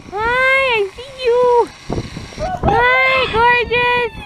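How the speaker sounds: high-pitched, drawn-out doting greeting; gushing pet talk. Real, not acted. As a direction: coo excitedly to a beloved pet. Calling and greeting a pet bird.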